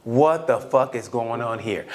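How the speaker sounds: slow, low disbelief; slow-burn retold astonishment; mock bafflement. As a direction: draw it out low, acting baffled. Acts his baffled reaction to fight.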